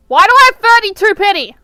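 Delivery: loud, rapid-fire disbelief; baffled outcry. Real, not acted. Baffled outcry at pity count.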